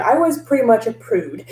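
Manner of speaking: normal talking voice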